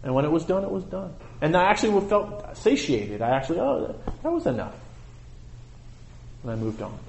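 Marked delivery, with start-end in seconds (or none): 3.6-4.8 breathy and much higher, soft; high contented sigh. Retold or imitated. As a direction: lift up into a satisfied murmur. Quoted inner voice, contented.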